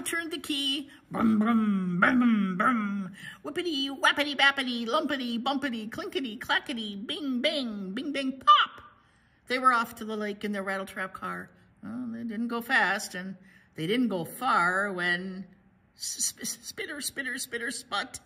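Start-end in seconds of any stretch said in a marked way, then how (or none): 1.1-3.1 loud hummed motor; big booming vroom noises; imitated engine revving. Imitates the car engine starting. 3.4-8.8 loud, bouncy sound-word chant; rhythmic clanking, bursting on the last word; rattling car noises. Acted. 16.0-18.2 stuttered, hissy engine splutter; halting spitty sputter noises; imitated sputtering.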